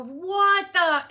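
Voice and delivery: loud high disbelief at the damage; shocked. Retold or imitated. Driver seeing the damage on her car, shocked. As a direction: blurt it in shock. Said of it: "I go".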